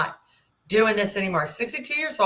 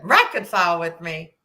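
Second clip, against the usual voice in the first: incredulous retort; 2 semitones higher, 4 dB louder than usual. Retold or imitated. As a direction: scoff at the absurd idea. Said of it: "And I said".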